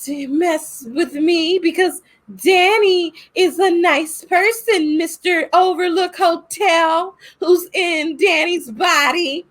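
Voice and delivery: put-on high, slow, girlish defiance; Abra's defiant voice. Girl talking down the hotel, high and slow. Voicing Abra defying the hotel.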